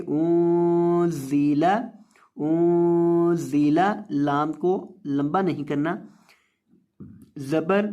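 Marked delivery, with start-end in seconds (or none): 0.1-3.8 انزل with the noon hidden and a hissing ز; slow انزل, nasal noon, sharp whistled ز; انزل nasal noon then whistling ز; ikhfa and whistling zay. Shown as a demonstration. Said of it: "زا میں سیٹی پیدا کریں گے".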